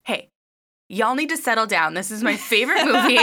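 put-on loud half-laughing scold to rowdy kids. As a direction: tell them to settle down, laughing. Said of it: "to be like".

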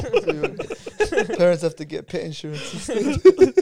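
slow, chuckling through a roast; laughing. Real, not acted. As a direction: laugh as you land the punchline. Teasing a friend, cracking up.

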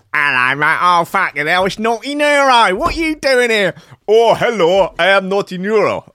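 high, loud mock greeting voice; put-on Neuro character. Trying out a voice for naughty Neuro, hammed-up and high. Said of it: "what kind of accent would he have".

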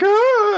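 short, very high yelp; high-pitched pretend scream; mock panicked scream. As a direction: give a quick high comic shriek. Crowd panics during the hold-up.

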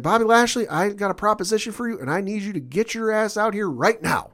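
mock call-out. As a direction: call him out like a wrestling promo, hammed-up. Brock calling Lashley out.

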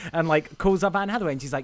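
ordinary delivery

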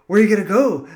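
loud, high leprechaun voice. Retold or imitated. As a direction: belt it out as a leprechaun. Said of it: "You can also say".

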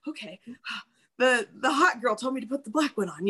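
sighing, deflated and quick; resigned. Retold or imitated. Giving in to the hot girl's advice, mild resigned sigh. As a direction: give in with a sigh. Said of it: "it just felt so".

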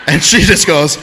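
ordinary delivery

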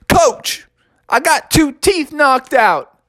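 put-on high, slow complaining kid; injured player reporting to his coach; whiny kid. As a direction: tell the coach what happened.

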